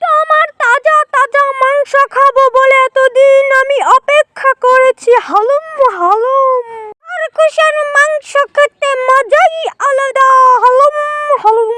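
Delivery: high animal villain voice, gloating lightly; mildly menacing jackal. Acted. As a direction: play a hungry jackal savouring prey.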